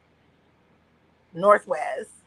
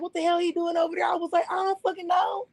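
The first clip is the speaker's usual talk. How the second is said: mother on the phone; 7 semitones higher than usual. Retold or imitated. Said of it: "The way that I'm speaking as my mother".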